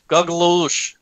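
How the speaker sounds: put-on heavy Russian accent, falling; Ivan Drago Russian accent. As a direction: say it like a Russian boxer. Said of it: "speak like Ivan Drago".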